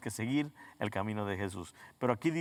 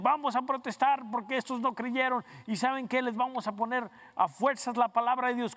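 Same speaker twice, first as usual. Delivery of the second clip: high-pitched, worked-up protester's cry, imitated; mock protest shouting; 9 semitones higher than usual. Preacher mimicking believers who rise up to protest, mock rallying cry. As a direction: act out a protester rallying people, pitched well up. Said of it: "empiezan a gritar".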